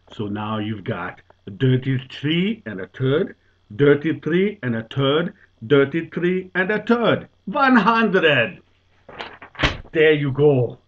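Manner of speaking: put-on old Dutchman loudly crowing in a Dutch accent; Dutch accent punchline. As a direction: ham up the accent to the payoff. Final punchline explained.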